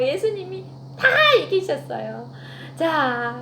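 usual speaking voice

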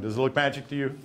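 normal talking voice

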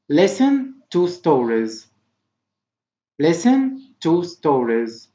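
very slow, separated words; slow model reading. Shown as a demonstration. Teacher reading vocabulary for pupils to repeat, slowly. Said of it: "Try to repeat after me".

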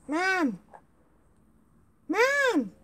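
rising loud calls, high on the last; calling Mom. Pretend. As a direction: shout for Mom to come. Child calling mother.

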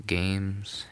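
ordinary talk, nothing marked

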